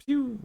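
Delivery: high-pitched tiny zap sound; light, high imitated projectile; little "pew" shot noise. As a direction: squeak out a toy-gun pew. Game master imitates ice shot.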